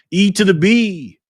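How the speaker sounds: slow, loud, high hype call-out; hyped name call. Acted. Streamer hyping a viewer's name, loud and slow. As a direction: stretch the name out like an announcer.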